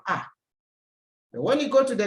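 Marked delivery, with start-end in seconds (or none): 0.1-0.2 A said as "ah"; unchanged A sound. Shown as a demonstration. Teacher showing that gâteau keeps its sound, A pronounced "ah". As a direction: say A as a plain French "ah". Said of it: "it keeps the same sound".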